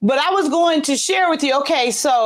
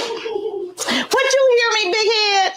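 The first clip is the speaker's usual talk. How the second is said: calling out; 8 semitones higher, slower than usual. Real, not acted. Calling to daughter through wall.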